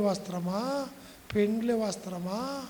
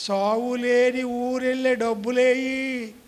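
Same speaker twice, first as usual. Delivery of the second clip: emphatic repeat; 3 semitones higher, 6 dB louder than usual. Real, not acted.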